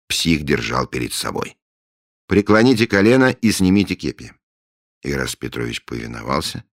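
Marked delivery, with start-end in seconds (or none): 2.3-4.3 ceremonial command. Acted.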